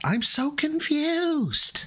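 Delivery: very high, drawn-out mock bewilderment; mock confusion. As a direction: stretch it out in a squeaky, exaggerated puzzlement.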